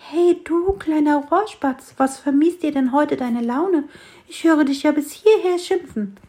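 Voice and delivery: gentle tree teasing the sparrow; friendly little fir tree. A narrator's reading. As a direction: call up cheerfully to a grumpy bird.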